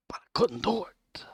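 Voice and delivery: hushed, fast whisper. Real, not acted. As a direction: confess it in a whisper. Whispered confession.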